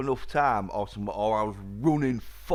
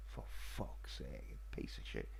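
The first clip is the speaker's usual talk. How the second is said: breathy under-the-breath swearing; whispered cursing; 13 semitones lower, 14 dB quieter than usual. Real, not acted. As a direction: hiss the curse to yourself.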